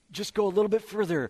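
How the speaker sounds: a pushy tempter egging you on; coaxing tempter. Retold or imitated. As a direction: lure them a little further, pushing hard. Culture voiced as a tempter.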